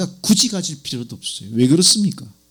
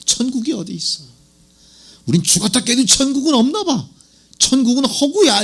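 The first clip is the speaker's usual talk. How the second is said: higher-pitched, let-down dismissal; disillusioned believers; 7 semitones higher than usual. Acted. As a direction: voice people giving up on heaven, pitched up. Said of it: "절망". (실망해 체념한 사람들 흉내, 높아진 목소리)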